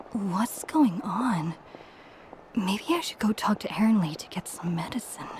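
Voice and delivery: soft, whispery musing to oneself; hushed self-talk. Acted. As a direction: whisper it privately, puzzled. Puzzled, talking to themselves.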